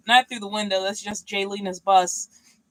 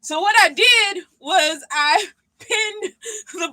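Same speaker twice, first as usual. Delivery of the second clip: laughing; 8 semitones higher, 5 dB louder, slower than usual. Real, not acted. Admits sewing workaround.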